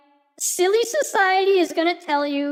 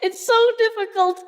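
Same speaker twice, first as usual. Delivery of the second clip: laughing; 5 semitones higher than usual. Real, not acted. Laughing about the challenge.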